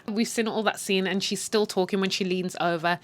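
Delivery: ordinary talk, nothing marked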